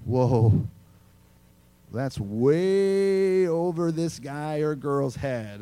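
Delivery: low, slow mock bewilderment; overwhelmed listener. Preacher imitates a confused listener, slow and low. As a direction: play the lost churchgoer, lightly. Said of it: "or you're like".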